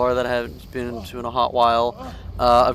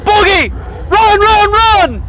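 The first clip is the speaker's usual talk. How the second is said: high frantic shouting; being chased; 12 dB louder, much slower than usual. Real, not acted. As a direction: scream it while fleeing. Fleeing the monster.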